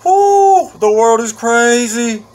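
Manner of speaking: high, loud whoop, then slow and drawn out; excited whoop. Real, not acted. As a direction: cheer like you just got out of jail. Fresh out of jail, celebrating.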